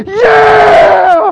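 very high, loud whoop; a preacher cheering at a movie, yelling high and loud. Retold or imitated. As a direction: jump up and cheer at the screen. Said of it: "stood up in the middle of the movie and went".

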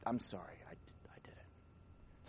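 mock sheepish apology trailing into a slow whisper; meek confession. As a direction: imitate a caught cheat mumbling sorry. Mocking athlete's meek confession.

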